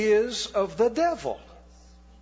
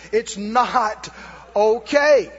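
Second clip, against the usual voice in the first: OKAY punched out loud and hard; loud rebuke; 6 dB louder, much slower than usual. Shown as a demonstration. Preacher rebuking the congregation, loud on okay. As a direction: hit "okay" hard to end the excuse. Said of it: "repeated rebuke".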